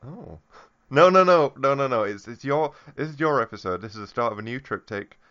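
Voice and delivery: ordinary delivery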